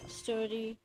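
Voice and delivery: ordinary talk, nothing marked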